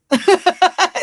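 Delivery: loud, high burst of laughter; real laugh. Real, not acted. Host admits nobody harmed her, laughing loudly. Laughs at the realization just voiced.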